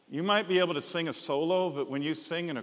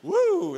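mock cheer; 9 dB louder than usual. Mock cheer after drum solo.

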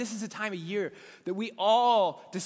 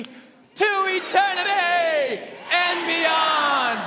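very slow, higher, louder stretched shout; long rally shout; 8 semitones higher, 6 dB louder, much slower than usual. Real, not acted. Pastor leading standing congregation in long shout. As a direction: drag it out at full volume. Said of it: "One, two, three".

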